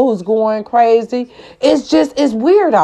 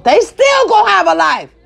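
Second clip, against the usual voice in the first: furious shout; 9 semitones higher than usual. Real, not acted. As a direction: yell it out in raw outrage. Furious rant about killers.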